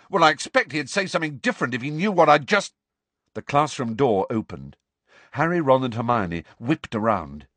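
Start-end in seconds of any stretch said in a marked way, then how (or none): 0.1-2.7 fast, high, heated comeback; rapid, exasperated arguing; angry retort. A narrator's reading. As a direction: fire back until cut off. Acted quarrel, cut off.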